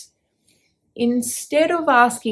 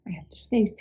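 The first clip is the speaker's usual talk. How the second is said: quick unvoiced whisper before a sneeze; 6 dB quieter, faster than usual. Real, not acted. Speaker warns listeners she has to sneeze, whispered. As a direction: breathe it out under your voice, holding back the sneeze. About to sneeze mid-talk.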